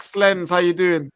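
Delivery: ordinary delivery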